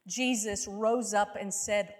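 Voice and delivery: usual speaking voice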